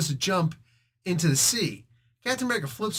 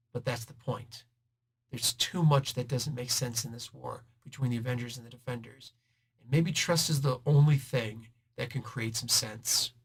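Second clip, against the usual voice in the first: softer and lower earnest talk; sincere plea; 4 semitones lower, 5 dB quieter than usual. Retold or imitated. Cap appeals to Namor to trust, soft and earnest. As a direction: speak it gently and earnestly.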